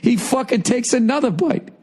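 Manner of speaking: high, strained words through real laughter; barely getting it out for laughing; laughing while talking. Real, not acted. Retelling friend's mistake, talking through laughter. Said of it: "we're fucking crying, laughing".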